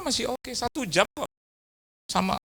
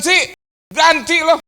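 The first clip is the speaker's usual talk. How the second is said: higher, loud mock-stern order; stern demand; 13 semitones higher, 11 dB louder, slower than usual. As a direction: demand she pay for it. Pendeta menuntut ganti kaca. (menuntut dengan keras)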